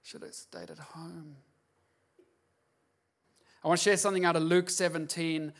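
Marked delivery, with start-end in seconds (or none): 0.0-1.4 softer, under the breath; a churchgoer regretting coming, muttering; inner thought. Retold or imitated. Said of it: "You're thinking".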